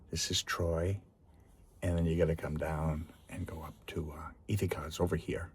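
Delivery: soft whisper over the map; quiet aside. Real, not acted. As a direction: murmur it to yourself while pointing.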